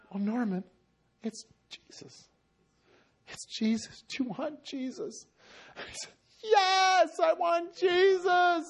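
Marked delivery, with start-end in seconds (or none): 0.1-5.3 soft, halting, careful question; acted tender invitation; gentle offer. Preacher retells gently offering Jesus. 6.4-8.6 high, drawn-out cry of acceptance; acted desperate acceptance; fervent yes. Acts out Norman's heartfelt answer.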